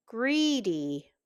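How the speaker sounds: plain reading voice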